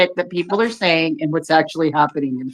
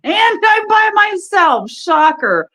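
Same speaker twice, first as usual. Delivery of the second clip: mock exasperated; 6 dB louder, much slower than usual. Real, not acted. Alone on stream, mock complaint.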